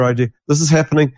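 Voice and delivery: normal talking voice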